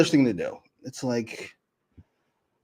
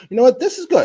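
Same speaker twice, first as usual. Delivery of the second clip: high, loud mocking quote; mock approval; 11 semitones higher, 7 dB louder than usual. Mimicking what his co-host supposedly thought, mock approval. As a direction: put on an incredulous, high-pitched approval.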